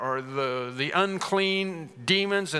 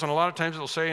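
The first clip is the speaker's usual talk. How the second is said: ordinary talk, nothing marked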